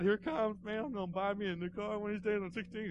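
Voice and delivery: high-pitched imitation of a giddy kid; excited boy. Ninth grader dreaming of a new car, imitated excitement. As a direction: act out a boy's mind racing. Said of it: "He was so excited".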